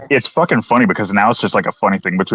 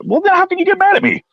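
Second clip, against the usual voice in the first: much higher mock protest; high indignant retort. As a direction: squeak out an outraged comeback. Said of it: "I'm like".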